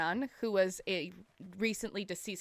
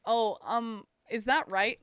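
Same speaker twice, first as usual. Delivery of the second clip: ordinary talk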